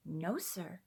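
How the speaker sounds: soft, weak little yes; faint child's reply. A narrator's reading. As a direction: answer barely above a breath, sick and tired. Sick child answers softly.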